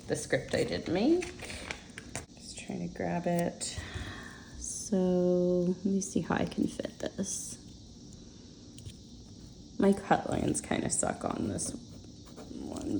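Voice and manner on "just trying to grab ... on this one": whispering. Real, not acted. Muttering to self while cutting stickers.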